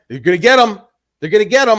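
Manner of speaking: loud, excited imitation; riled-up dad. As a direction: crow it like a news-hooked father.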